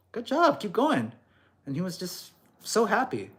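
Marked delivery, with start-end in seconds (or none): 0.1-1.1 slow, higher, cheering him on; encouraging coach. Retold or imitated. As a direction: praise him like a proud coach.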